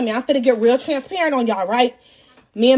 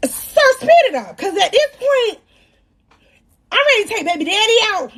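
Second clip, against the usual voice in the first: high-pitched, exasperated demand; worked-up and high; impatient retort; 7 semitones higher than usual. Retold or imitated. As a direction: hurry the doctor along, voice jumping up. Impatient retort to slow doctor.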